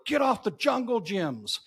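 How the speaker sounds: high-pitched mock holler, no real volume; hollering dad. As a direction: imitate a dad calling out the back door. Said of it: "your dad is hollering out the back door".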